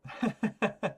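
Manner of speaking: bright burst of laughter; high laugh. Real, not acted. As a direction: laugh at the wasted card. Laughs at the useless card.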